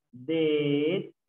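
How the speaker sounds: "destrui-" as des... trui, slow; slow, broken-up "destrui-"; syllable by syllable. Shown as a demonstration. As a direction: hold each syllable of "destrui-" apart. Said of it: "Sería de la siguiente manera".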